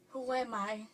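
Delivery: barely awake, murmured to yourself; hushed, disoriented just-woken voice; groggy waking up. Acted. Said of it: "So sometimes I'm like".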